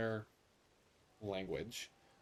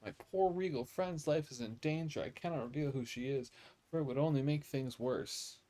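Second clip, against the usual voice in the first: dramatic lady; 6 semitones higher, 4 dB louder than usual. Acted. Reading NPC lady's lines dramatically.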